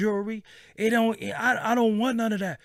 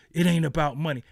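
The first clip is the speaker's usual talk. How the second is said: ordinary delivery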